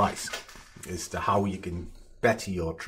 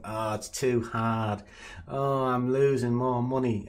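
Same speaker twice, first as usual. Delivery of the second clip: whinging trader; 3 semitones higher, slower than usual. Retold or imitated. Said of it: "the whingers going".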